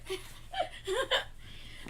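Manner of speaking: unvoiced wheezing laugh; breathy laugh. Real, not acted. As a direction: silent shaking laugh. Laughs after showing flag.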